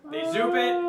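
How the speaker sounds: zoop sound effect. Acted. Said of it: "Your onomatopoeias are just on point".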